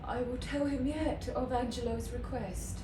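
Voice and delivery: measured classical delivery with long pauses; resolute Shakespearean monologue. Acted. Isabel choosing chastity over her brother's life, resolute classical delivery. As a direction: start high and settle into grave resolve.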